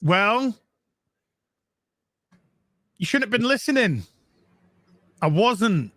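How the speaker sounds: a hint of the Doctor's teasing line; quoting the Doctor. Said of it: "What did he say?".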